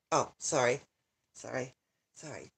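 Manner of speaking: fading apologies. Real, not acted. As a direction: apologize while trailing away. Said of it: "I'm a little emotional".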